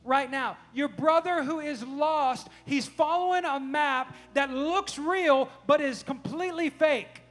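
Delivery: loud, slow, emphatic scolding; freaking out. Retold or imitated. Dad explaining to son that his brother is lost, worked up and emphatic. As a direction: hammer it home to the child, word by word. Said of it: "I'm kinda freaking out".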